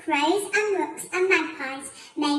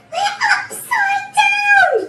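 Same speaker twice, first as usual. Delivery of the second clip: slow, high, loud wail; horrified gasp; 8 dB louder, much slower than usual. A narrator's reading. Mister Twit realising he is upside down, horrified gasp. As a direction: stretch it out in loud horror. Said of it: "gasped Mister Twit".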